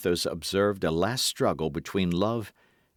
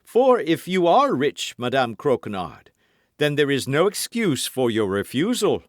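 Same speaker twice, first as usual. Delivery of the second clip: lightly voiced swaggering musketeer, raised pitch; Porthos voiced; 7 semitones higher, 7 dB louder than usual. A narrator's reading. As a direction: play Porthos pleading with mock hurt. Porthos presses her.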